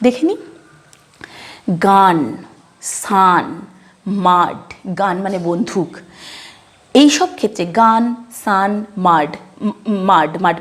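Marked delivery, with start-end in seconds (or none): 1.9-4.6 "গান, সান, মাড" with open aa vowel. Shown as a demonstration. Teacher demonstrating to learners, open aa in "গান, সান, মাড". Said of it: "আ এর মতো উচ্চারণ করব".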